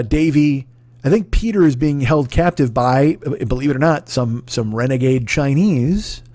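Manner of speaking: ordinary talk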